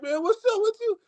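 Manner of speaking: high, put-on voice for the dad; a hint of a confronting dad; imitating Nemo's dad. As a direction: do the other father stepping in. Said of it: "he was like".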